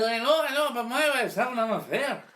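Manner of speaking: high, loud answer thrown back; shouted reply. Acted. Third drunk shouting back on his way out the door, high and loud. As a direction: call it back over your shoulder, high and loud.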